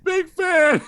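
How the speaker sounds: high, loud goofy fan voice; hammed-up superfan; dopey fan voice. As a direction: blurt it like an eager simpleton. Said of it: "And I just went".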